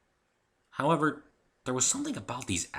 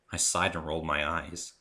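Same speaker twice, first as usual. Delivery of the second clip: usual speaking voice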